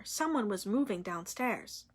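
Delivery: ordinary delivery, nothing marked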